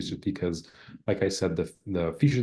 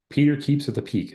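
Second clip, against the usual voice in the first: raised demo sentence; 8 semitones higher, 4 dB louder than usual. Shown as a demonstration. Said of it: "that should not be hypernasal".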